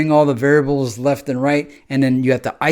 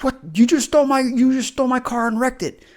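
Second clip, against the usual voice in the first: angry yell; 11 semitones higher than usual. Retold or imitated. Confronting the person who wrecked your car, yelling. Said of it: "yell at them about it".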